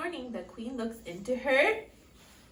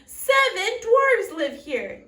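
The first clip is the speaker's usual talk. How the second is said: excited; 5 semitones higher, 11 dB louder, slower than usual. A narrator's reading. Storyteller introduces the dwarves.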